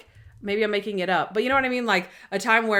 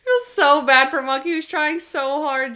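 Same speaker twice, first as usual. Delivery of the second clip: laughing; 5 semitones higher, 4 dB louder than usual. Real, not acted. Laughing through the comment.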